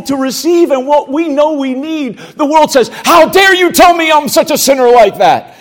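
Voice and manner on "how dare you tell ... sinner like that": loud, offended outcry; indignant shout. Retold or imitated. The world shouting back at the preacher, indignant. World objecting to being called sinner.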